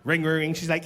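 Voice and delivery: normal talking voice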